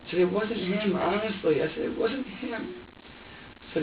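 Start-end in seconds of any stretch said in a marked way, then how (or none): 0.2-2.8 high, earnest pleading; distressed, pleading reassurance; upset insistence. Retold or imitated.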